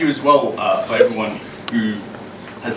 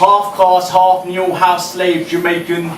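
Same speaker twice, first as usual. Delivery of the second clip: raised, ringing performance poem voice; higher and louder performance reading; spoken word poem; 7 semitones higher, 5 dB louder, slower than usual. Acted. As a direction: deliver each line to the room like a slam poem.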